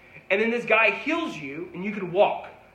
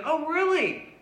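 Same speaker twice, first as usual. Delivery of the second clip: surprised; 9 semitones higher, slower than usual. Retold or imitated. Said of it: "And he says".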